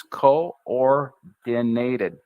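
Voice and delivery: Coordinated stretched very slowly, N pushed twice; slow syllable drill. Shown as a demonstration. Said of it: "Push with the N twice".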